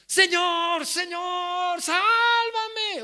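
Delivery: high, loud, desperate calling out; panicked cry for help. Acted. Preacher voicing Pedro crying to the Lord, panicked high cry. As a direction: cry out to be saved as you sink. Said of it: "dio voces diciendo".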